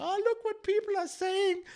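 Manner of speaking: high, whiny mock of a journalist complaining; sneering high impression of a self-pitying journalist. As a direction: lift it way up and whine it like a wounded reporter. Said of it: "be like".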